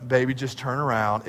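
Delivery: put-on calm the little girl down; gentle dad. Father answers gently.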